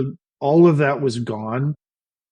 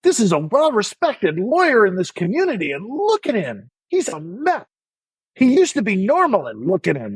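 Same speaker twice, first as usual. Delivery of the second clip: much higher, scornful scolding; berating doctor; 7 semitones higher than usual. Retold or imitated. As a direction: shame him in front of the paramedics. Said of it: "he is berating me".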